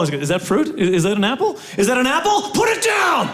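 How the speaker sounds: from suspicion to a high shouted order; mock-alarmed officer, rising sharply in pitch; escalating border guard. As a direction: build into a hammed-up bark of command. Acted border guard escalating over fruit.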